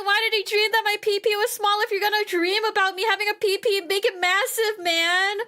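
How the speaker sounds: high, whiny indignation; mock offended. Real, not acted. Complaining to viewers about his dream in high mock hurt. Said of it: "I wasn't even offended".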